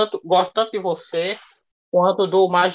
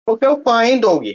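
louder and higher, a cheerful burst of praise; eager fan shout-out; 6 semitones higher, 5 dB louder than usual. Real, not acted. Apologizing to fan Doug, then an eager louder shout-out. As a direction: beam it out to a fan.

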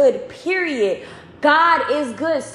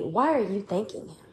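soft, lowered questioning voice; insinuating enemy; 5 semitones lower, 9 dB quieter than usual. Retold or imitated. The enemy asks a believer, insinuating. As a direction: ask it quietly, planting doubt.

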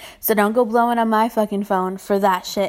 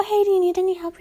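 chirpy offer; 9 semitones higher, faster than usual. Retold or imitated. Offering help to customers.

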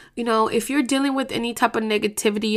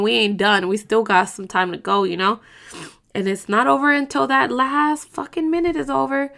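ordinary talk

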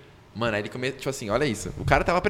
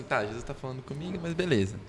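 much softer, grudging aside; muted inner thought; 10 dB quieter than usual. Retold or imitated. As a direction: say it under your voice, half-resigned. Retells a downcast, grudging inner thought.

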